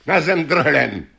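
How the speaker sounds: high-pitched urgent order; calling out. A narrator's reading. Magic horse ordering Janko to drop it, calling out. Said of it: "zvolal tátoš".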